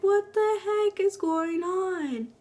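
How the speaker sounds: terrified at footsteps in the night; high drawn-out frightened question; freaking out. Retold or imitated. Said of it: "I was freaking out".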